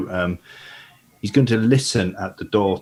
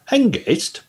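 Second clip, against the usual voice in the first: put-on higher, curious boy's voice; young lad voice; 10 semitones higher, 4 dB louder than usual. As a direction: ask it like a wide-eyed lad. Gully questions Hengist in character.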